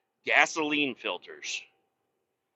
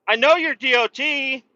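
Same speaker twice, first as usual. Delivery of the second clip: louder, higher call to the officer; exasperated; 10 semitones higher, 6 dB louder than usual. Real, not acted. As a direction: tell the DOT officer you know, loudly. Addressing trooper behind.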